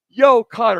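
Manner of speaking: loud, high call of Yo, Connor; all caps yell. Shown as a demonstration. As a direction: shout the name like five exclamation marks. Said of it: "in all caps and, like, five exclamations".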